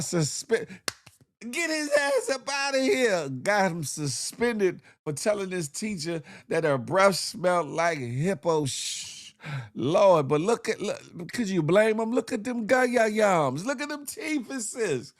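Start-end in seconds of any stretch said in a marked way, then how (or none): 1.5-3.3 mock outrage. 12.1-15.1 mock disgust. Mocking the teacher's teeth.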